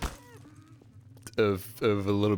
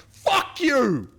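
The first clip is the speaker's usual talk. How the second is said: mock outburst; 7 dB louder than usual. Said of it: "I finish up going".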